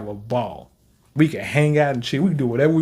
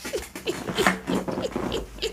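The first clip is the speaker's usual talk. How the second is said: squeaky breathless giggle; a tiny high laugh he can't hold in; 9 dB quieter than usual. Real, not acted. Reacting to Sukuna's line, squeaky breathless giggle. Reacting to a shocking anime scene.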